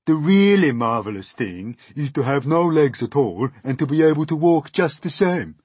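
high, loud earthworm lecture; opinionated earthworm. Acted. Earthworm praising life without legs, opinionated voice. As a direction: declare it with loud swoops.

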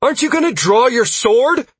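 high, loud urgent question; shouting. A narrator's reading. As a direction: yell it across a battle. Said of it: "Sierra shouted at me".

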